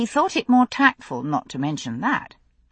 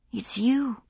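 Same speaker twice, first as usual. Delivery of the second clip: whisper; 6 semitones higher, 4 dB quieter than usual. A narrator's reading. Worried lover confides softly.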